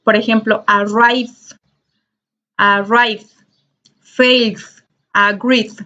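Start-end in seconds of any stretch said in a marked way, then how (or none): 0.7-3.3 ARRIVE ending in a voiced D; final D sound. Shown as a demonstration. Teacher shows students the D ending, final D sound on arrive. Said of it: "la vamos a pronunciar como D". 4.0-5.7 FAITH said as failed, ending in D, then AGREED; final D sound. Shown as a demonstration. Teacher models past tense verbs, final D sound on faith, agreed. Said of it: "la vamos a pronunciar como D".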